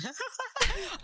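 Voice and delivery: squealing high laugh; high burst of laughter. Real, not acted. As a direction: laugh out in delight. Laughing at big hit.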